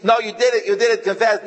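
high, loud accusing yell; furious innkeeper shouting at a suspected thief; angry accusing shout. Retold or imitated. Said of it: "beating him and saying".

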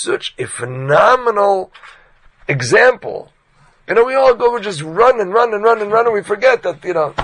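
loud high heartfelt sermon push; impassioned preaching. Real, not acted. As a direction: urge the listeners passionately. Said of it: "it ripped my kishkas out".

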